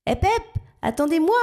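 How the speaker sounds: high calling shout. A narrator's reading. Frog calls after the departing birds, high shout. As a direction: shout after them to wait up. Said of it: "crie une grenouille".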